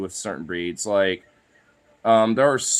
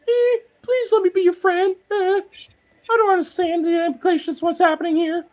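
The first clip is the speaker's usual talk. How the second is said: very high, timid pleading dog voice; voicing his dog Sukov; 4 dB louder than usual. Owner voices his own dog pleading with the bulldog, very high. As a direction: squeak it like a clueless pup begging for friendship. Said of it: "and Sukov's like".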